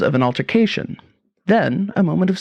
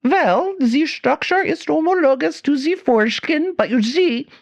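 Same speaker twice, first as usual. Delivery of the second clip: fake German accent; 12 semitones higher than usual. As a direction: play the mad German professor, thick fake accent. German professor continues.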